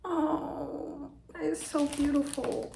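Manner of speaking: tearful and overwhelmed while opening a gift; real teary crying. Real, not acted. Opening a gift from her co-host, real teary crying. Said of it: "I'm literally crying".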